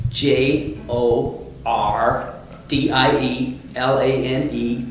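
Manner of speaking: slow, hammed-up American accent spelling; bad American accent. Shown as a demonstration. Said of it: "that's a pretty bad American accent".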